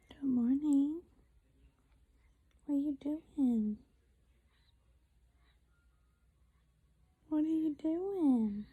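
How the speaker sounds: soft cooing to a newborn. Real, not acted.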